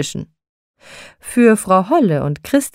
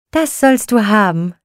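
lightly raised Christkind call; 3 semitones higher than usual. A narrator's reading. Christkindchen promises Nikolaus his fire, calling out. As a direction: call it out, a touch brighter in pitch. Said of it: "rief Christkindchen".

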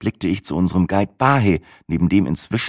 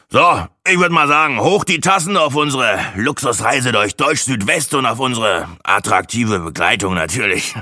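toast like a big shot; condescending toast; 5 semitones higher than usual. A narrator's reading. Braggart toasting the group, patronising. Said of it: "gönnerhaft zu einem Toast".

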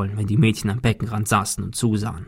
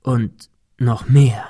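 plain reading voice

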